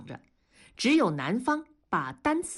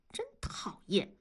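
hushed, softer grumble of annoyance; peeved whisper; 8 dB quieter than usual. A narrator's reading. Student fed up with a teasing sibling, peeved whisper. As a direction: grumble it under the breath.

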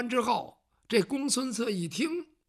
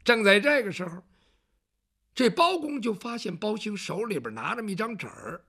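ordinary delivery

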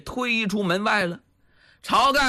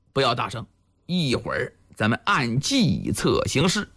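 much lower conspirator's voice; low, confiding; 9 semitones lower than usual. Acted. Village chief warning the captive to keep it down, low and secretive. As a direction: keep it low, between the two of you. Secret plotting, warns against loudness.

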